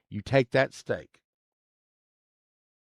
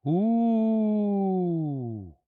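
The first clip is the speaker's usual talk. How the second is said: impressed ooh; 10 semitones higher than usual. Real, not acted. Reacting to a find.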